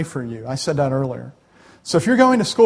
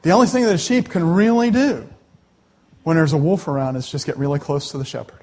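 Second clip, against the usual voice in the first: ordinary talk, nothing marked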